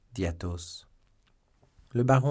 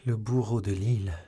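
terrified cry. A narrator's reading. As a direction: cry it out in horror. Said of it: "en proie à une terreur insensée".

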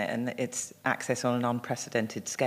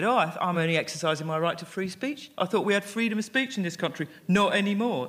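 higher, mock-patronising protest; slightly patronising; 4 semitones higher than usual. Online troll defending free speech, in a slightly patronising voice. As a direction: imitate a self-righteous complainer, slightly patronising. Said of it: "in that slightly patronising accent".

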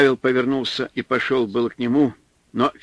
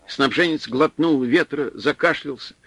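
ordinary delivery, nothing marked

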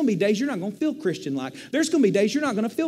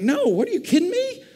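indignant self-rebuke; 5 semitones higher than usual. Retold or imitated. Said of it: "I'm like".

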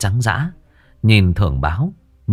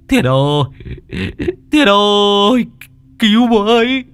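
very high, loud drawn-out scream; terrified cry for help; 13 semitones higher, 4 dB louder, slower than usual. A narrator's reading. Old Thưởng fleeing the demon, screaming for Thiên. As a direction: scream for rescue in terror.

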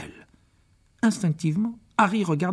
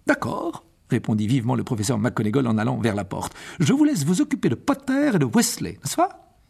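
brisk McGonagall. Acted. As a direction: snap a quick agreement and go. McGonagall voiced. (McGonagall vive et pressée)

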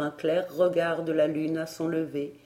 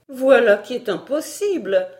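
higher, loud objection; emphatic refusal; 6 semitones higher, 8 dB louder than usual. Acted. Barbara refusing to shut the window, emphatic. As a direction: rule it out loudly.

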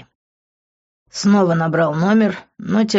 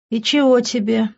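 lightly taunting, higher-pitched question; mocking; 5 semitones higher than usual. A narrator's reading. Rival answers the narrator's phone call mockingly. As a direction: toss it out with a touch of mockery. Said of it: "насмешливо спросил Винс".

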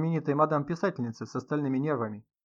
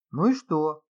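higher, louder defensive outburst; sudden agitation; 4 semitones higher, 7 dB louder, slower than usual. A narrator's reading. Artyom answers the host, suddenly agitated. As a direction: get worked up, louder on the repeat. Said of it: "заволновался вдруг Артём".

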